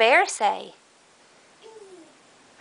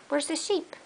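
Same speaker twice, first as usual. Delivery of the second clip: higher, much softer, rising question; high soft coaxing; 6 semitones higher, 8 dB quieter than usual. Real, not acted. Parent gently coaxing toddler to find the sheep, high and soft.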